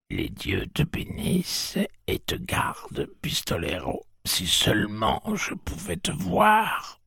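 whispered blessing that swells at the end; old blind woman. A narrator's reading. Old Mercy blesses the gunslinger, hushed then crying out.

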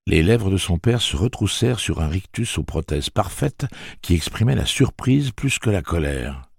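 ordinary delivery

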